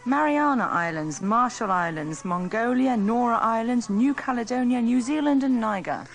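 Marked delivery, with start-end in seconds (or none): none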